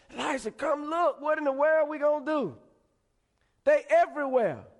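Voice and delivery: high, loud cries of alarm; panicked servant. Acted. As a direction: call out in acted panic.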